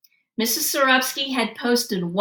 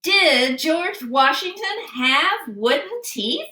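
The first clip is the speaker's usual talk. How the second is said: slow, higher quiz host voice; game show question; 7 semitones higher, slower than usual. Acted. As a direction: pose the big question slowly.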